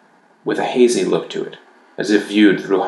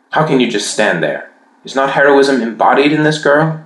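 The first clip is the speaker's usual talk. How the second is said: rising, louder indignant challenge; indignant outcry; 7 semitones higher, 3 dB louder than usual. A narrator's reading. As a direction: hurl it at the silent knight. Shaking her fist at knight.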